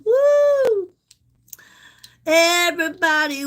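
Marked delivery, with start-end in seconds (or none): 0.0-0.9 woo. Real, not acted. Reacting to viewer compliment.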